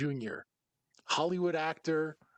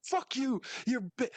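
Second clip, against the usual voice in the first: mock angry fan; 7 semitones higher than usual. Toxic fans cursing at the actor online, mock rant. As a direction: spit insults like an online troll, pitched up.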